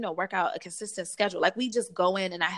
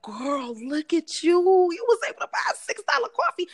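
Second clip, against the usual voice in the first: high, loud, fast hype talk to herself; self-celebration; 9 semitones higher, 4 dB louder than usual. Retold or imitated. Praising herself for affording a coffee, high and fast. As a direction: cheer yourself on like a best friend. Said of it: "and being like".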